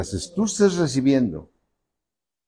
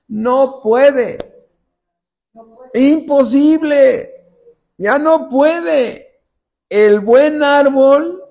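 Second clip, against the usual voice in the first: high, loud, slow preaching; preaching at full force; 9 semitones higher, 6 dB louder, slower than usual. Acted. Preacher thundering the verse to the church, high and loud. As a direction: proclaim it to the congregation.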